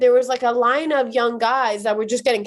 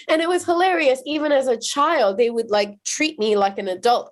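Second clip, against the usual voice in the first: usual speaking voice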